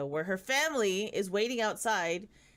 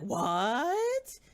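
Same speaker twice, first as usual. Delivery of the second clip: high mock disbelief; bewildered, rising question; real heiress hearing the diagnosis, stunned; shocked; 5 semitones higher, 3 dB louder than usual. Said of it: "the real heiress is like".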